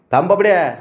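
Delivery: ordering the cat to wait, loud; shouting stop. Real, not acted. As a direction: bark the command at the cat.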